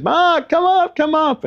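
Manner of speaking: very high, loud imitated holler; mimicking a woman's high-pitched shout; calling out. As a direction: call a friend up from down a driveway. Kylie calling down the driveway.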